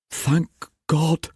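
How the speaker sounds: high, softened sigh of relief; relieved. Acted. Father learning his son is safe, relieved. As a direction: let the fear go in a high exhalation.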